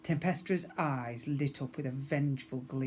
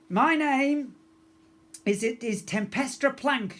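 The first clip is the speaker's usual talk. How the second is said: higher, louder proud yell; 10 semitones higher, 6 dB louder than usual. A narrator's reading. Pirate girl announcing herself to the hall, loud yell. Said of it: "she yelled".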